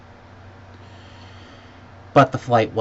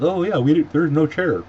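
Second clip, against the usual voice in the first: higher, louder put-on staff voice; mock airline staff; 8 semitones higher, 6 dB louder than usual. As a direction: imitate a useless employee's excuse, pitched up. Imitating airline staff's excuse.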